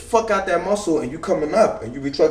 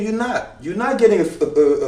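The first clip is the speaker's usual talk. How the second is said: usual speaking voice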